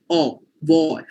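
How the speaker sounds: "war" with the open aw leaned on, v-like w; emphasis on the A. Shown as a demonstration. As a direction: lean on the vowel of "war", Indian English style. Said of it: "we put more emphasis on the A over here".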